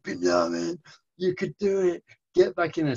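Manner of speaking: high, loud mock-heroic urging; rousing pep talk. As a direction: send him back into battle, hammed up. Father's climactic movie-style pep talk.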